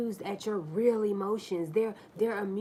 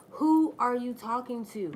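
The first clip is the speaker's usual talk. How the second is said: louder, higher challenge; louder own asking; 5 semitones higher, 8 dB louder than usual. Retold or imitated. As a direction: throw it back at him. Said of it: "That's what I had asked him".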